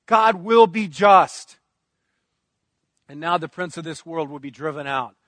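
ordinary talk, nothing marked

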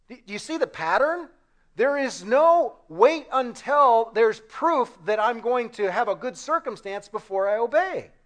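ordinary talk, nothing marked

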